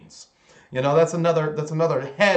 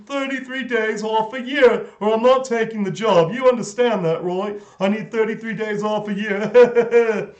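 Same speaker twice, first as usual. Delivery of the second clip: higher, put-on British voice making demands; hammed-up British job applicant; mock British worker; 5 semitones higher than usual. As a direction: lampoon an entitled British employee. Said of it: "They hired another British person".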